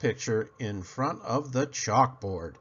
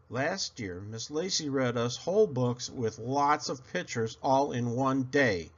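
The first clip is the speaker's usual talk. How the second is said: ordinary delivery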